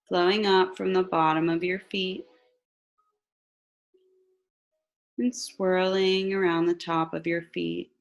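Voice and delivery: slow, calm meditation voice; guided meditation. Acted. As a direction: guide them gently into relaxation.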